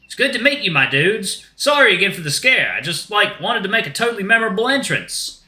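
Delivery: high, easygoing dude voice; friendly shark dude. Acted. Shark meets Axel's friends, high friendly dude voice. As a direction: apologize like an amiable surfer dude.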